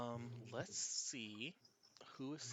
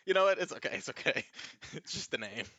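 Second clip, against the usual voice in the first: brave-faced student; 5 semitones higher, 10 dB louder, faster than usual. Acted. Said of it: "Haru and goes".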